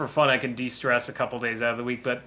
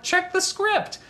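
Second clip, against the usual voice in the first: Doug's reply; slower than usual. Retold or imitated. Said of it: "And he's like".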